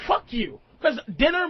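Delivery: ordinary talk, nothing marked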